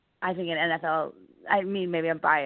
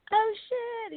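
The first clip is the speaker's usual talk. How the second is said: much higher squeal of surprise; mock panic. Friends seeing Warren Sapp approach, high startled squeal. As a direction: yelp it, caught off guard.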